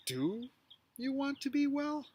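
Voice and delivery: "be" lifted high and stretched; stress on be. Shown as a demonstration. Teacher showing stress on "be" in Jesus' question. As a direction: lean hard on "be" as you ask. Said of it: "you could emphasize any one of those words".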